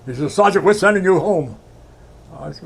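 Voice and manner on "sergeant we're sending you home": a raised, louder officer's voice, lightly done; imitating his officer. Veteran imitates his officer sending him home, louder. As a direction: play the officer giving the order. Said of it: "I do a good imitation of him".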